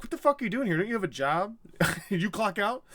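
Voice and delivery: high-pitched mock outrage; comic exasperated boss; incredulous scolding. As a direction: tell off a slacking worker in disbelief. Acted Gandalf scolding Pippin.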